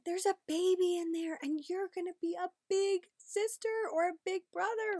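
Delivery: put-on excited kid-directed sing-song; exciting. Shown as a demonstration. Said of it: "try to make it exciting".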